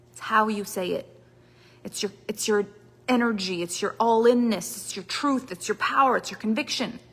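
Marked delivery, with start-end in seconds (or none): none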